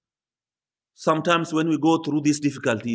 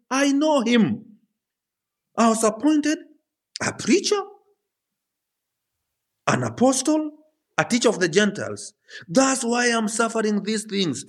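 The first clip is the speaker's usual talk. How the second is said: impassioned testimony; 7 semitones higher than usual. Acted. Preacher voices Paul's testimony.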